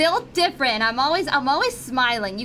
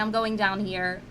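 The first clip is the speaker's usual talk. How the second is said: usual speaking voice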